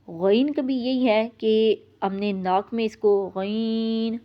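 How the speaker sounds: normal talking voice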